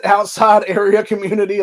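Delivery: amused, incredulous repeat; incredulous mocking. Real, not acted. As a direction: scoff at the euphemism with a laugh. Mocks the euphemism he just read.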